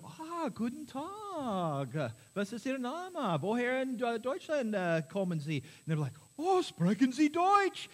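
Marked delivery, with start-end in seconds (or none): 0.0-5.6 higher, slow, eager German greeting; playful bartender speaking German; friendly bartender German. Retold or imitated. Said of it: "So I'd walk up to them". 6.4-7.9 much higher, slow, delighted surprise; German tourist delighted to hear German; excited tourists. Retold or imitated. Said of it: "They were all excited".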